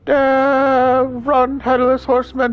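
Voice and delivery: high frantic shouting; panicked yelling while running. Acted. Frantic escape from horseman.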